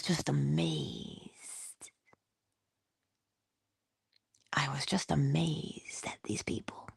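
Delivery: soft awed whisper; hushed awe. Real, not acted. Recalling famous voices, whispered amazement. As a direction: let it fall to a whisper of wonder. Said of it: "whispered awe".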